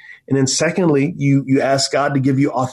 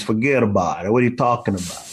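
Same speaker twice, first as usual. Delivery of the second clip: lower, quick Queens Italian patter; Italian-American Queens accent; 3 semitones lower, 3 dB quieter, faster than usual. Acted. Imitating best friend from Queens, Italian-American New York accent. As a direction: play a Queens Italian guy, pitched down. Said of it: "an Italian guy named Joe. He was from Queens".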